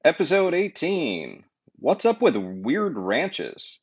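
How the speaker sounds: lively announcer intro over music; podcast intro read. Acted. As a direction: sell the show like a host opening.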